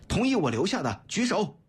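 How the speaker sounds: high-pitched commanding call; loud roar. A narrator's reading. Leader calling for a show of hands, roaring. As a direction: call for the vote forcefully. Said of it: "大声吼道".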